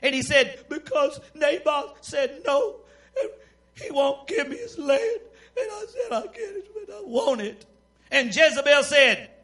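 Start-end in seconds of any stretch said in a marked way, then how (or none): 0.7-7.6 high, tearful mock sobbing; whiny tantrum. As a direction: whine like a child told no. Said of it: "That sounds like a little temper tantrum".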